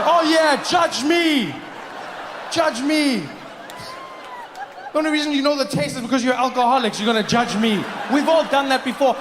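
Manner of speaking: defiant shout, daring them to judge; mock outrage. Real, not acted. Shouting at the audience.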